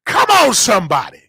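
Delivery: ordinary talk, nothing marked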